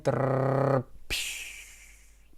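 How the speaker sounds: rolled tongue trill into a long hush; trilled filler noise. Real, not acted. Teacher pausing to think it over, trill and soft hiss. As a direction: trill "trrr", then let out a soft "psh". Fills a pause while thinking.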